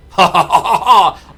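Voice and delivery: loud gleeful laughter. Real, not acted. Streamer thrilled at reaching industrial mining, laughing loudly. Delighted at tech tree success.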